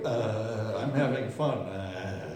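low, soft mock drone; listless mock "having fun"; mock bored fun-seeker. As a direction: lightly imitate someone zoned out having fun. Mimics listless amused person.

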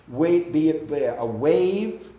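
ordinary talk

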